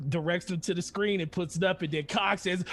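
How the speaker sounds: ordinary talk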